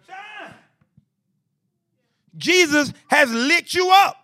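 ordinary delivery

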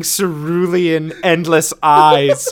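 drawn out slowly; slow and dreamy. Real, not acted. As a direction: linger on each word in awe. Gushing over art.

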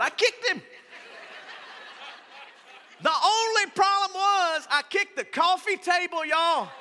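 high, loud, laughing through the story; laughing about kicking the coffee table, high and loud; laughing at own pain. Real, not acted. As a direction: tell it half laughing, half hurting. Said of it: "I'm serious".

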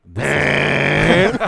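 loud, held alert-tone imitation; emergency broadcast. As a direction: blare it like a broadcast warning. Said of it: "like, an emergency broadcast".

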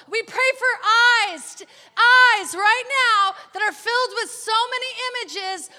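loud prayer breaking into laughter; laughing fervent shout. Real, not acted. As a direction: cry it out, laughing with joy.

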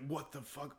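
very soft, stunned aside; hushed disbelief. Retold or imitated. Viewer reacts to the dancer's death, hushed disbelief. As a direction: retell it almost under the breath.

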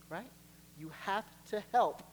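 normal talking voice

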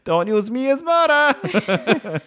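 high, laughing mock Italian accent; lightly hammed-up Italian accent, as a scolding box. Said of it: "And it's got an Italian accent".

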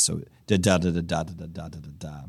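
da da da chanted as iamb then anapests; sing-song da syllables trailing away; rhythm in da syllables. Shown as a demonstration. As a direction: beat out the meter on da. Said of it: "An anapest is a unstressed, unstressed stress".

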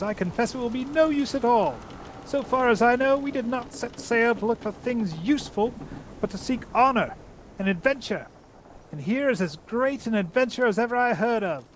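high, lofty mouse-knight voice; proud Reepicheep. Acted. Reepicheep lectures the captain on honor, high and lofty. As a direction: declaim like a tiny noble knight.

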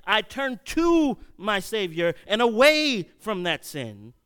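high, loud preaching build; preaching at full force. Acted. Preacher drives home repentance to his friends, loud and high. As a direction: preach it hard and rising to the congregation.

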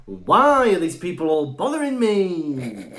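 slow, drawn-out, hammy actor; William Shatner. Retold or imitated. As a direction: overplay it slowly like a theatrical star. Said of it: "It's like William Shatner".

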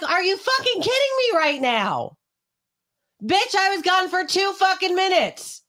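very high, slow, incredulous rage; furious outburst. Retold or imitated. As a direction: yell in disbelief at the dog. Finding dog with chicken, furious.

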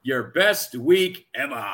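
slow sign-off, stretching the last word; slow, showy sign-off; drawn-out catchphrase. Pretend. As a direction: draw it out like a show catchphrase. Show sign-off catchphrase, praised after.

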